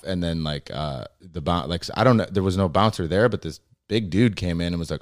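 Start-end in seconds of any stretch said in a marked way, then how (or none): none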